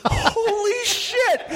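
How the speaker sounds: very high, drawn-out exclamation with laughter; amused disbelief. Real, not acted. Co-host hearing the kid broke down crying, amazed. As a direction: stretch it out high, astonished and laughing.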